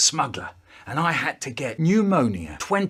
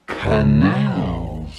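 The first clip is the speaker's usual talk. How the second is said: deep voice; 11 semitones lower than usual. Acted. Punchline word.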